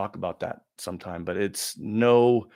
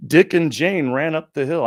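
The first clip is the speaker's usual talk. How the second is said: put-on child reading aloud; 6 semitones higher, 7 dB louder than usual. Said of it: "I was reading exactly backwards".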